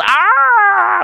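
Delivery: high, held monkey screech; wild monkey scream. Retold or imitated. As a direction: scream like a monkey going berserk. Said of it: "the monkey went wild".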